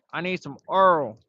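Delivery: mock his grandma asking for Earl; imitating grandma. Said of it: "She'd be like".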